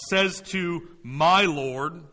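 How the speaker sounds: slow phrase, weight on "my"; stress on MY. Shown as a demonstration. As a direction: lean hard on "my" before "Lord". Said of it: "possessive adjective, my".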